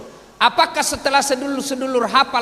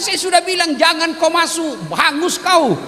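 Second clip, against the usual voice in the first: mock warning; 9 semitones higher, 3 dB louder than usual. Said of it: "Dia bilang".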